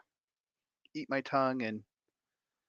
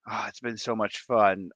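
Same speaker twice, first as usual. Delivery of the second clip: ordinary delivery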